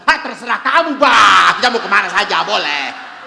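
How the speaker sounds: loud, booming husband, half laughing; loud laughing shout from a blunt husband; blunt Batak husband. Acted. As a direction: boom it out like a hearty Batak man. Batak husband answers wife.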